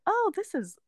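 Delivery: high, wide-eyed retold awe; amazed. Tourist arriving at the airport, high amazed self-imitation. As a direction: play your own dazzled arrival, pitched high. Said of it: "I was like".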